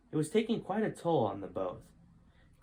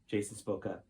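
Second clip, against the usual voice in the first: usual speaking voice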